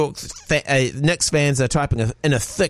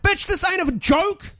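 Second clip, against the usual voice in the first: mock outraged fan. Angry fan reply.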